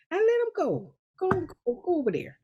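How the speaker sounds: high falsetto dismissal; airy, high-pitched good riddance; playful send-off. Acted. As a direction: shoo them away in a high little voice. Playfully dismissing nasty people.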